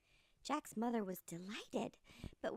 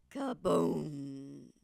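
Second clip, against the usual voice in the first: deep, loud booming crash; crash sound; 9 semitones lower, 8 dB louder than usual. Acted. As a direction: boom it out like a huge fall. Beanstalk crashes to ground.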